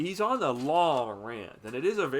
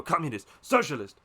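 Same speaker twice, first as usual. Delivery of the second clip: each "Communist, socialist" louder and higher; mocking yell, building; 6 semitones higher, 7 dB louder than usual. The father shouts down his son, rising to a yell. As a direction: shout it down, escalating. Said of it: "just yelling at each other".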